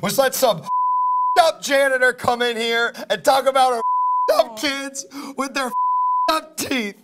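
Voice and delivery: high-pitched, outraged tirade; furious rant. Acted. Bandmate blaming the host after the show got pulled, furious rant.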